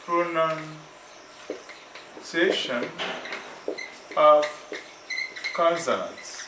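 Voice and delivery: "pronunciation of consonants" broken up with long pauses; slow model phrase. Shown as a demonstration. Teacher modelling the lesson title for learners, slow and broken up. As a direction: say "pronunciation of consonants" very slowly, piece by piece.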